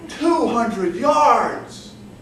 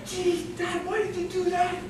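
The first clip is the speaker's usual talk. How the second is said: put-on embarrassed teen; 10 semitones higher, 3 dB quieter than usual. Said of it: "And I".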